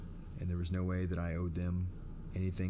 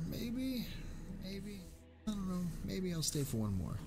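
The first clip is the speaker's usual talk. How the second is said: coy high sing-song; 11 semitones higher, 4 dB quieter than usual. Acted. Coy hint for viewer donations.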